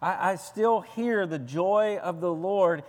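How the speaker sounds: usual speaking voice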